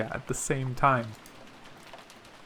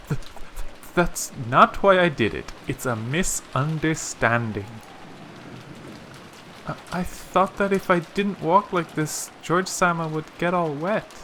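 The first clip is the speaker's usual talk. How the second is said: stammering, embarrassed protest; flustered servant; 2 semitones higher than usual. Acted. Servant explaining a misunderstanding to teasing cousins, flustered. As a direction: trip over the first words as you explain yourself.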